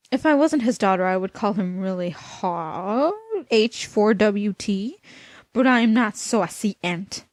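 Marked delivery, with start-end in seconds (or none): none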